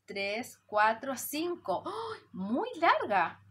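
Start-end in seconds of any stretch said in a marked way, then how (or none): 1.9-3.4 mock surprise. Long word surprises teacher.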